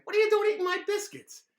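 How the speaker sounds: high, loud squeaky dog voice; indignant dog protesting; cartoon dog complaint; talking dog. Dog speaks in story.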